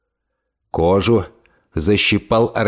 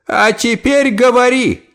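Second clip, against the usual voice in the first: commanding; 10 semitones higher than usual. Acted. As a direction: command it firmly. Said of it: "приказала тётушка Тильди".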